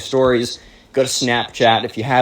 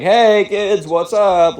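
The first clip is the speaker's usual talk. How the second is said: high, breezy dad greeting; lightly hammed-up friendly dad; upbeat dad; 9 semitones higher, 3 dB louder, much slower than usual. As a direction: greet the kids like a cheery dad.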